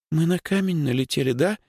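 high, small, scared voice; frightened babble. Acted. Girl asks whether they hit a rock, frightened. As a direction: ask it in a frightened little voice. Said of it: "испуганно пролепетала Лёлька".

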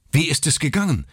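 high, urgent gasped question; panting father's question; breathless blurting. Acted. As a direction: blurt it out breathless while running. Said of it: "stieß Mister Button keuchend hervor".

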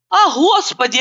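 «А Господи» cried out high with a falling wail; wailing lament. A narrator's reading. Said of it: "охая, закатывая глаза и причитая".

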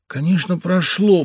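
high, loud glad agreement; gladdened. A narrator's reading. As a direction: happily confirm it. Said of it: "обрадованный". (обрадованный муж соглашается)

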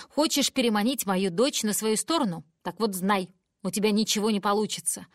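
loud furious mother's tirade; angry shouting. Acted. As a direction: yell it at grandma. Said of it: "кричит мама". (разгневанный крик матери)

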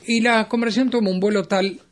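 a lightly faked elegant phrasing; mock refined. Shown as a demonstration. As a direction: put on airs for the word conversación, conversación lifted high and elegant. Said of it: "mirá qué fino".

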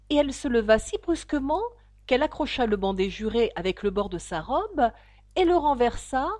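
usual speaking voice

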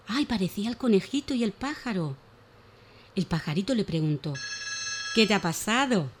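put-on lively, animated reading of a children's tale; expressive children's story voice; storytelling for children. A narrator's reading. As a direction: tell it like a bedtime story for little ones.

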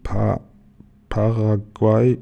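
slowly attempting to read it; halting reader, syllable by syllable; sounding out a word. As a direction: feel your way through the word slowly. Tiger tries to read the sign.

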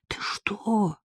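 hushed, high gasp of horror; horrified. A narrator's reading. Marika invited to sleep at the neighbours', horrified. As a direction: recoil in soft, aghast disbelief. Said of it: "Марийка пришла в ужас".

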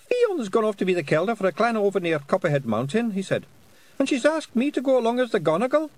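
high, loud Scottish pixie; squeaky little Scots fairy. A narrator's reading. Pixie figure voice.

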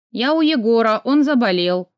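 plain reading voice

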